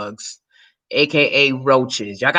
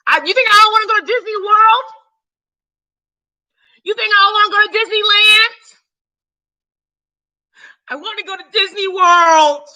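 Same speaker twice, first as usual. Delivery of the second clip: much higher, louder, pleading; exasperated high rant; 6 dB louder than usual. Real, not acted. Speaker insisting she wants Disney but fears roaches, high loud protest. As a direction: protest it over and over. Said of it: "ranting about flying roaches".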